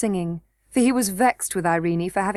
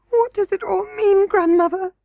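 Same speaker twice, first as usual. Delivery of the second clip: much higher, tearful question; sobbing; 10 semitones higher, 4 dB louder than usual. A narrator's reading. Princess to her grandmother, high and tearful. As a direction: ask through tears. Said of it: "Irene sobbed".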